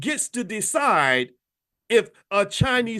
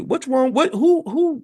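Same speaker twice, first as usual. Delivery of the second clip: higher, louder outburst; exasperated; 9 semitones higher, 5 dB louder, slower than usual. Real, not acted. Host scolding viewers in disbelief, higher and louder. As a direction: fling it out in disbelief.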